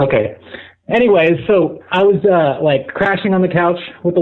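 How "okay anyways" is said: ordinary talk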